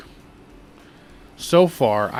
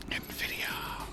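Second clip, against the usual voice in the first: whispered aside; 17 dB quieter than usual. Real, not acted.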